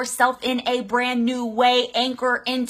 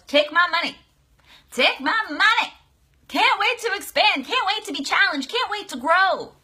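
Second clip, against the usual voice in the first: higher, eager rush of wants; eager excitement; 7 semitones higher than usual. Retold or imitated. Coach voices her thrill at hiring a coach, eager and high. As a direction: hand over the cash gladly. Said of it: "the excitement that I thought about the shift was".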